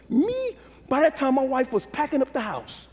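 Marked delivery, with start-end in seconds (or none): 0.1-0.6 disbelief. Real, not acted. Repeated disbelief peaks.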